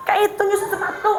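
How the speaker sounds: high, laughing mock scold of a clumsy child; scolding mother. (ibu mengomeli anak soal jemur handuk, omelan pura-pura bernada tinggi)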